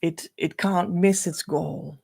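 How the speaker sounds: usual speaking voice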